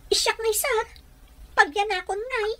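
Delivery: high, tearful begging; crying plea. Acted. Maid begging her boss through tears.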